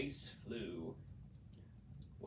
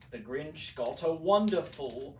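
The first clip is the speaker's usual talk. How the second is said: ordinary delivery, nothing marked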